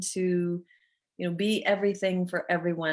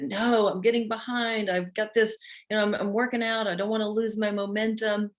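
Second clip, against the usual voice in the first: higher, insistent ego; pushy ego voice; 5 semitones higher than usual. Retold or imitated. Her ego arguing for the gym, higher and insistent. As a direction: play the stubborn ego that won't quit. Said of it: "my ego said".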